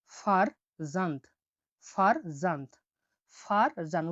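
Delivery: ফারজান্ত said very slowly, each sound drawn out, then repeated twice; slow careful demo. Shown as a demonstration. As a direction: spell out ফারজান্ত slowly, syllable by syllable. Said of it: "উদাহরণ আমরা দেখছি দেখুন". (ফারজান্ত ধীরে ধীরে স্পষ্ট উচ্চারণ)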